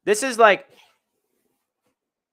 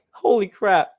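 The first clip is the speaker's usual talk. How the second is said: loud, amazed burst; genuinely amazed holy crap; excited exclamation; 3 semitones higher, 7 dB louder, slower than usual. Real, not acted. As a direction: blurt it out in disbelief. Amazed at replayed play.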